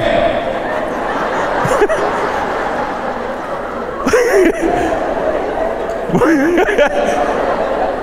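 airy wheezing laughter; long unvoiced giggle; high breathy laugh. Real, not acted. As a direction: break up laughing at the joke. Laughs at the joke.

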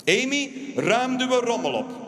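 put-on slow firm order to a child; stern dad. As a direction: lay down the law to the kid. Father ordering daughter.

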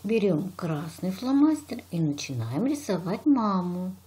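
ordinary talk, nothing marked

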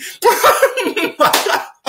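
high squealing laughter; long, high giggling fit; cracking up. Real, not acted. Storyteller laughs at own crude reply, cracking up.

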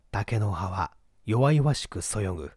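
plain reading voice

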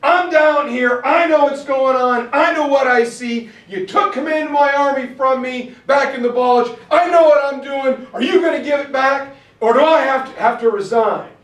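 loud, high angry outburst; Bradley freaking out. Retold or imitated. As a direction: shout it in exasperated protest. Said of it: "Bradley freaks out and goes".

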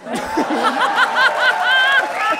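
high, long burst of laughter. Real, not acted. Inspector laughs at the food in thirteen suitcases, high.

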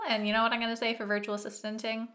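ordinary talk

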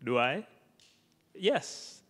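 ordinary talk

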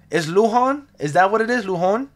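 Lujan with the J as an H, asked with a questioning rise; testing "loo-HAHN" aloud, unsure; trying out the name. Shown as a demonstration. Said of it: "Hopefully I'm saying that correctly".

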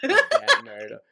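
mock Boston accent. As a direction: parrot the Boston accent for a laugh. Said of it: "don't wanna give an example".

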